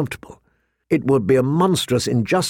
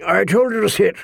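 higher-pitched gruff rebuke; grumbling old commander; 8 semitones higher than usual. A narrator's reading. Commander rebukes his standing steward, grumbling. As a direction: grumble the order impatiently. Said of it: "Mormont grumbled".

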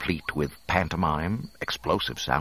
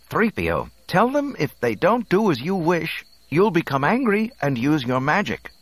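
higher, louder command; firm instruction; 12 semitones higher, 5 dB louder than usual. A narrator's reading. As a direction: instruct the droid with authority. Luke gives the droid instructions.

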